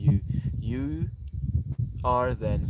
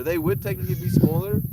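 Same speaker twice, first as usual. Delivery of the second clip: higher-pitched, wondering aloud; questioning; 7 semitones higher, 3 dB louder than usual. Real, not acted. Streamer working out whether they would be smaller, questioning. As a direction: puzzle over it in a high voice. First take questioned, then confirmed.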